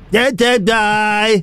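slow sung-out imitation; imitating background singer. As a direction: drawl it like the distant singer. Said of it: "listen, right from the beginning".

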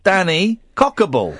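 "Danny Cockable" spelt out slowly; slow, incredulous repeat of "Danny Cockable"; child's mispronunciation. Retold or imitated. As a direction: slowly mimic the garbled name "Danny Cockable". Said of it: "How can you not understand the words".

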